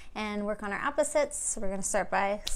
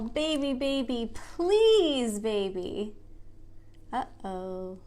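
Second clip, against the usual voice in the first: slow, sing-song picture book reading; reading to children; much slower than usual. Acted. Teacher reads a picture book aloud to the kids. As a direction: read it gently to little ones.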